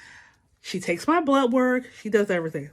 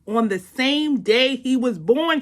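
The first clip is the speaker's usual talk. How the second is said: ordinary talk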